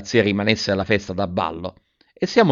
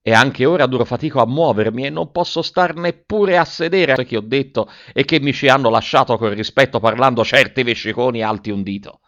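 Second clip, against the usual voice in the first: usual speaking voice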